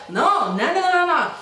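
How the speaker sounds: mock protest leaping high; mimicked refusal. Spanish girl refuses to use the dryer, mocking imitation. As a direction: mimic her refusing, pitch swooping up. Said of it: "And she was like".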